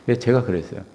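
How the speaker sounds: ordinary delivery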